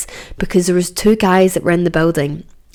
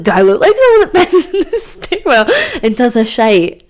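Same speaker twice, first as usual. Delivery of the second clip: high, laughing indignation; indignant high laughing question; laughing offended retort; 7 semitones higher, 4 dB louder than usual. Retold or imitated. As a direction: laugh through the outrage.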